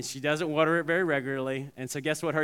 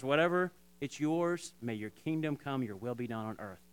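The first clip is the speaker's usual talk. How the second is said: quick prayer; 2 semitones lower, 5 dB quieter than usual. Shown as a demonstration. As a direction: pray quietly and simply, unhurried. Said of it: "just say just a quick prayer".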